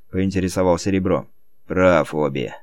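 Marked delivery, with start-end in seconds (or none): 1.7-2.5 grumbling. Acted. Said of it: "проворчал Торпеда".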